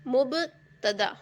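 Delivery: very slow, syllable by syllable; drawn out. Shown as a demonstration. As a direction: stretch the word out as if dictating it. Teacher dictates the term while writing. (ٹھہر ٹھہر کر، کھینچ کر)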